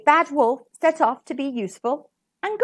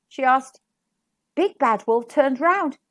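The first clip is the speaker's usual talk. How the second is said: ordinary delivery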